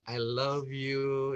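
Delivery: slow, tender, drawn-out love line; sincere declaration. Shown as a demonstration. Coach replaying the first example for listeners, slow and sincere. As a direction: stretch it gently and mean it. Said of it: "ya, satu".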